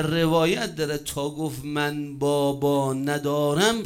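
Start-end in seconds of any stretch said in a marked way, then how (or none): 1.6-3.8 put-on very slow, stretched-out retelling of a child's words; drawn-out orphan's reply. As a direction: draw out each word for weight. Orphan's answer repeated for effect. (پاسخ کشیده و شمردهٔ کودک یتیم)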